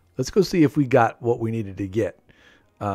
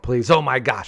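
usual speaking voice

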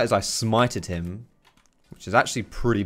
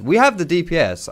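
1 emphatic retort; 8 semitones higher, 7 dB louder than usual. Real, not acted. Arguing with team about damage.

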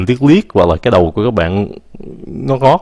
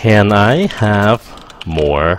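slow and careful, word by word; slow model phrase; much slower than usual. Shown as a demonstration. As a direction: say it slowly so learners can copy. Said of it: "các bạn sẽ nói rằng là".

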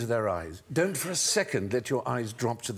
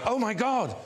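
shocked gasp; 12 semitones higher than usual. Retold or imitated. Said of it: "everybody went".

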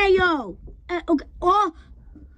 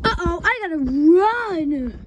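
alarmed shout; 3 semitones higher, 9 dB louder than usual. Real, not acted. Fleeing the animatronic.

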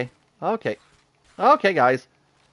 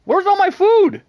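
much higher and louder cry of alarm; dismayed yell; 7 dB louder, slower than usual. Real, not acted. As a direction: shout it in real dismay at the empty stash. Finds food gone in a fight.

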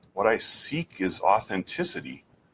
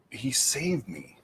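hushed whisper, slow, with higher pitch; whispered quote; 4 semitones higher, 7 dB quieter, slower than usual. Retold or imitated. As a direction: breathe it out like a secret confession. Pastor's quiet testimony, retold.

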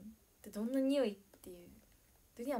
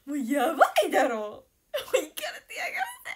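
loud, high outburst breaking into laughter; incredulous laughing; 9 semitones higher, 11 dB louder than usual. Real, not acted. Ranting about staff who love durian, incredulous laughing. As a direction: cry out in tearful, laughing disbelief.

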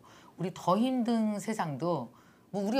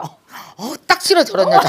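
loud, high mock sneer; mock disgust at the eager one, higher and louder; scornful gossip; 9 semitones higher, 16 dB louder than usual. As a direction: sneer like a catty neighbour mom. Gossiping mothers mocked behind back.

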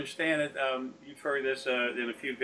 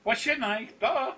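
mock cheeky retort; 10 semitones higher than usual. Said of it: "I said".

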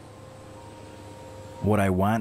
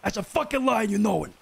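much higher, furious outburst; angry accusation; 9 semitones higher than usual. Acted. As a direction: call out the lie in rage. Accusing friend of lying.

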